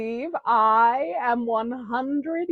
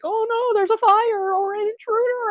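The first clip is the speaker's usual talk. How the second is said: mock scared; 11 semitones higher than usual. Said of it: "They get all scared".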